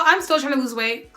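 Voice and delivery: ordinary talk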